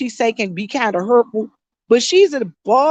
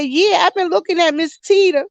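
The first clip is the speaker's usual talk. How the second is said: imitating sister; 6 semitones higher than usual. Quoting her older sister.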